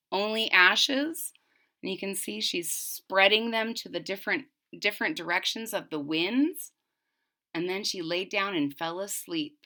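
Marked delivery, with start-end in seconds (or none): none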